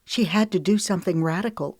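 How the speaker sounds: ordinary delivery, nothing marked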